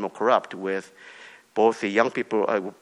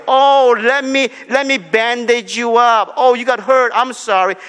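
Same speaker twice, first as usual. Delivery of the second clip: mock soothing dad; 11 semitones higher, 9 dB louder than usual. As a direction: ham up the coddling parent. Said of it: "He would not say".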